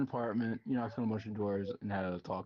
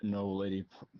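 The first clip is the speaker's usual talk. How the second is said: ordinary talk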